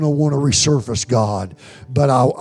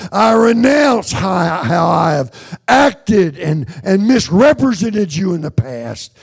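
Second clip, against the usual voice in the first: raised, high-pitched cry to God; fervent preaching prayer; 5 semitones higher than usual. Real, not acted. Preacher renounces his faults before God, impassioned prayer.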